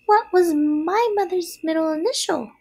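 high, squeaky spider question; little spider. A narrator's reading. Second spider asks Wilbur, small voice. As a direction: ask in a tiny high voice. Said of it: "asked the second spider".

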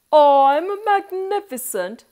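grand king. Acted. King boasts to his subjects, grand proclamation. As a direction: proclaim it like a pompous monarch.

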